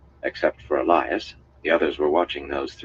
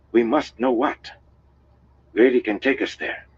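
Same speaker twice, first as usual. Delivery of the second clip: high, insistent woman's voice, building louder; urgent outpouring; 7 semitones higher, 4 dB louder than usual. A narrator's reading. Aes Sedai pleading that they must know, urgent. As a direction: plead with mounting urgency, pitched up. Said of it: "the words rushing out of her".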